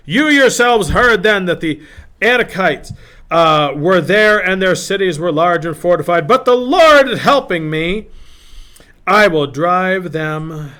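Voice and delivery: loud, high, emphatic Bible reading; rousing scripture declamation. Acted. Preacher reads an old warrior's speech to listeners, rousing declamation. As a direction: proclaim it with rising fervor.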